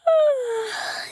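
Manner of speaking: long, high, audible sigh of relief; relieved sigh. Acted. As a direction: let the breath go in relief. Relieved at finding the book.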